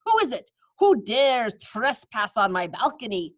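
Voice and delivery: outraged witch, stretching the threat; angry witch. Acted. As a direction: witch demanding who trespasses on her balcony.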